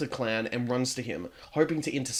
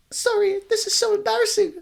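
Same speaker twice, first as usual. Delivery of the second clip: put-on very high, breathy-sounding girl voice, a little louder; embarrassed ghost girl close to tears; high-pitched shy ghost girl; high tearful ghost girl; 5 dB louder than usual. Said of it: "The crying girl".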